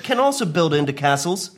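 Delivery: ordinary delivery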